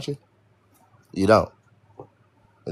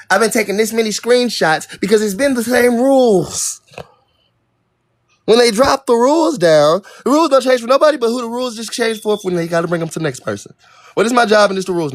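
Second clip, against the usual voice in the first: fed-up streamer ranting about rules; angry; 9 semitones higher, 4 dB louder than usual. Real, not acted. Angry rant about chat rules.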